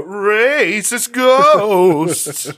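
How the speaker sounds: playful swooping haunted-house call; spooky ghost voice. Pretend. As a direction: stretch both words like a hammy ghost. Callback to spooky racist bit.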